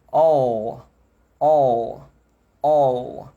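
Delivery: all with a long dark L; dark L demo. Shown as a demonstration. Teacher asking the class to watch his mouth, dark L. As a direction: draw out all, tongue back. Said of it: "vou repetir".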